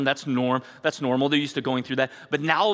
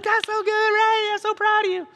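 much higher, beaming praise; proud dad praise; 3 dB louder than usual. Retold or imitated. Father cheering his child Ray after the jump, high and delighted. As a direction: gush over the child like a thrilled parent.

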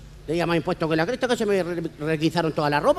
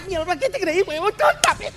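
high-pitched angry yelling; furious wife; 11 semitones higher than usual. Acted. Wife yells at her husband while hitting him, high-pitched. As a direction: screech at him.

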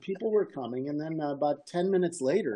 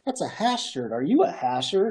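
lightly imitated Irish accent, higher pitched; 5 semitones higher, 5 dB louder than usual. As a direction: put on a slight Irish accent. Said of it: "in his Irish accent".

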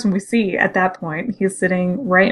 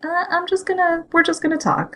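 high, softer let-me-handle-it voice; high, soft coaxing; 9 semitones higher, 4 dB quieter than usual. Retold or imitated. Windblade steps in to talk, high and soft. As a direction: gently shoo your partner aside, high.

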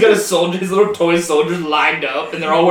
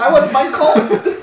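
mock ten-year-old; 9 semitones higher, 4 dB louder than usual. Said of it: "like, a ten-year-old".

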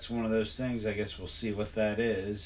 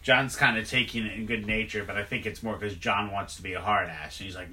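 usual speaking voice

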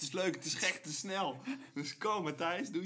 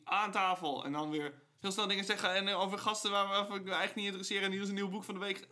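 fast rattling; 3 semitones higher, faster than usual. Retold or imitated. Mocking the fast talk-show host.

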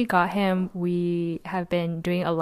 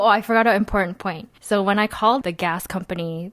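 ordinary talk, nothing marked

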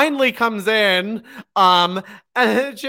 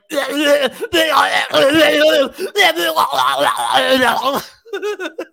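loud wheezing laugh that won't stop; helpless laugh fit; 4 semitones higher, 4 dB louder than usual. Real, not acted. Laughing fit over the wrestling brawl, wheezing. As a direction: lose it completely, gasping.

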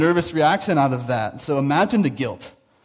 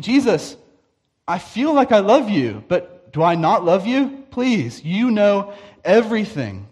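pleading Peter; 6 semitones higher, 4 dB louder than usual. Retold or imitated.

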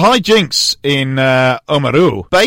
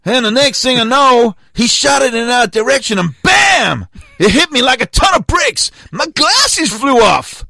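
hammed-up American accent, building to a shout; 8 semitones higher than usual. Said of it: "I was gonna do the accent".

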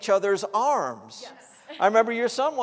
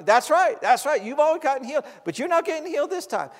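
higher-pitched insistent taunt; the devil; 7 semitones higher, 3 dB louder than usual. Retold or imitated. Preacher replays the devil denying healing, high-pitched taunting. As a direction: pitch it up and press the denial. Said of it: "and this voice".

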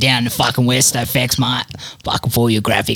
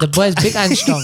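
higher-pitched mock Chinese accent; badly faked Chinese accent, pitched up; 7 semitones higher than usual. Podcaster improvising gym-gear sponsor read, mock Chinese. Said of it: "the underclass in Chinese".

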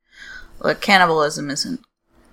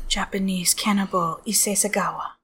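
breathy horrified whisper; 3 semitones higher, 6 dB quieter than usual. Real, not acted. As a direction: whisper it in disgust. Echoing the name in horror.

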